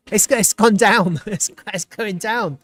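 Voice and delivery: high-pitched words breaking into real laughter; incredulous laughter. Real, not acted. Streamer sees the price falling despite good news, laughing incredulously. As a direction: say it cracking up in disbelief.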